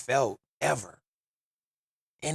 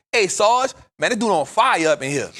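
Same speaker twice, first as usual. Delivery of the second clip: mock calm call to the guard; 6 semitones higher, 7 dB louder than usual. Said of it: "I gotta say it with the calmest voice".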